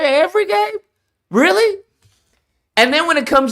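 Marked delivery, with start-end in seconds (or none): 0.0-1.8 incredulous. Real, not acted. Incredulous at influencers.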